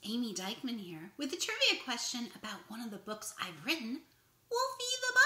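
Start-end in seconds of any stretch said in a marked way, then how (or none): none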